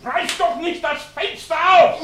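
hoch und laut, schreiend; panischer Ruf. Acted. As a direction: ruft ihm entsetzt zu. Hält ihn vom Fenster zurück. (laut und hoch aufschreien)

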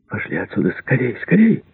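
mild hurrying cry, rising high at the end; urgent shout. A narrator's reading. Freed prisoner urges the others out, understated urgent shout. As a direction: hurry them along, pitch leaping up without much volume. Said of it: "крикнул Бэнкс".

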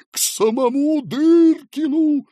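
high, loud, drawn-out cry; frightened announcement. Acted. Fat man explaining visitor to gatekeeper, frightened announcement. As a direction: call it out in a fright.

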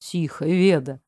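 low, hushed reassurance; soothing. A narrator's reading. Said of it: "уговаривал он". (тихо, успокаивающе)

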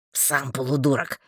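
low, mildly huffy retort; understated sulky comeback; offended. A narrator's reading. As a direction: throw the insult back, hurt. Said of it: "обиделся Марстон".

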